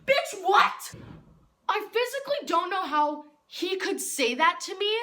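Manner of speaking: loud, high-pitched indignant outburst; outraged disbelief. Acted. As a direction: blow up in theatrical disbelief. Outraged at classmate's callous remark.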